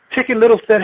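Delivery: put-on lively read-aloud to children; storyteller reading a picture book; story reading. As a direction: tell the fable with bright animal voices. Reads Chicken Little aloud.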